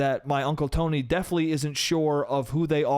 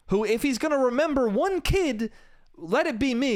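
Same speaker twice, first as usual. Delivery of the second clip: higher, pleading outrage; indignant; 7 semitones higher than usual. Real, not acted. Comic venting to audience, higher and exasperated. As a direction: push it up in exasperation.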